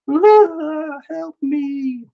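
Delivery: very high, drawn-out little squeal; mock cry for help. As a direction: squeal like a tiny cartoon damsel. Drawn figure cries for help.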